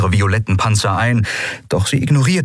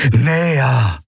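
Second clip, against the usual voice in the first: raised, drawn insistent word; urging; 4 semitones higher than usual. A narrator's reading. Eidolon pressing Kai toward the field, insistent urging. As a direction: coax the hand forward firmly. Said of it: "drängte das Eidolon".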